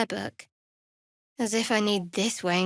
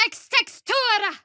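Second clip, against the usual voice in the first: shouted incantation; 12 semitones higher than usual. Acted. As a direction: cast the spell with a high ringing shout. Spell incantation in combat.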